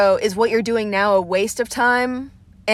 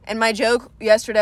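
ordinary talk, nothing marked

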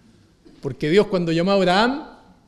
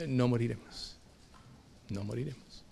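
much softer, fading almost to a breath; hushed conviction; 5 semitones lower, 13 dB quieter than usual. Acted. Preacher voicing Habakkuk to congregation, near-whispered conviction. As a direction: breathe it like a private certainty.

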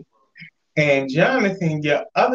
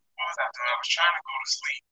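whisper; 8 dB quieter, faster than usual. Real, not acted. Voice dropping out on call.

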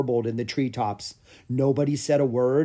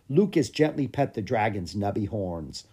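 usual speaking voice

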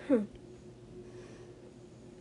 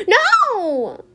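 gasping no; 10 semitones higher, 5 dB louder than usual. Real, not acted. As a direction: gasp then loud high no. Gasps as queen lands.

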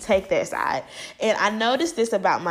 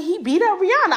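higher mom voice breaking bad news; mom's voice; 11 semitones higher, 3 dB louder than usual. Mom telling her daughter what Chris did, higher voice. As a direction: play the mom, voice up high. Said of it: "And she was like".

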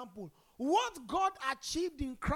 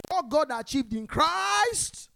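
loud, slow and higher, every word pushed out; with your chest; 4 semitones higher, 11 dB louder, slower than usual. Shown as a demonstration. Preacher showing the congregation how to declare it, loud and slow. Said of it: "Say it with your chest".

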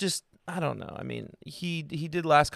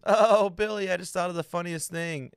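Norm's character; 5 semitones higher, 3 dB louder than usual. Retold or imitated. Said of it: "He goes".

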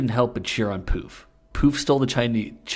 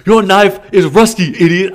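a much higher, much louder mock Chinese accent; hammed-up fake Chinese accent, half laughing; Chinese accent attempt; 10 semitones higher, 15 dB louder than usual. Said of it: "Should I be adding a Chinese accent".

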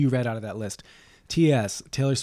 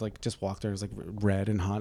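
ordinary talk

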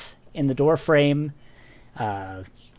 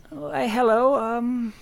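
politely puzzled, much higher voice; confused old lady; 10 semitones higher than usual. Acted. Old woman greets a stranger at her door, confused and high-voiced. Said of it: "looks at you rather confused".

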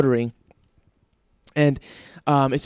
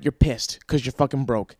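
usual speaking voice

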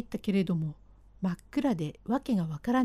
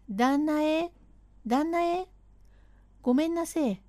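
high, raised call through the door; 6 semitones higher than usual. Acted. Servant calls his master at dawn, high raised call.

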